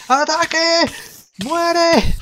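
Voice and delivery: high, loud attack yell; battle cry. Real, not acted. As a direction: scream it charging in.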